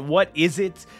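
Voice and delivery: ordinary talk, nothing marked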